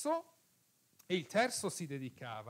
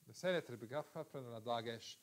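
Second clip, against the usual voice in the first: quick quiet recap; 3 semitones lower, 6 dB quieter, much faster than usual. Shown as a demonstration. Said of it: "quick repeat of the rule".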